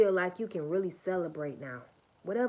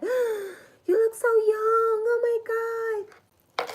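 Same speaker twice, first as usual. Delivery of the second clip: gasping amazement; 9 dB louder than usual. Retold or imitated. Store lady shrieking in disbelief. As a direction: gush like you can't believe it.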